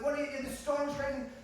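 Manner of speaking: high-pitched frantic protest; frantic yelling. Acted. Disciples yelling at Jesus in the storm, high and frantic. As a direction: cry out over a storm. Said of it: "they're yelling at Jesus".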